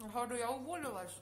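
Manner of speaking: speaking after a bite; talking while chewing. Real, not acted. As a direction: mouth partly full. Seen 1: Cheeks full, lips pursed just after spoonful; normal talking from 3.1.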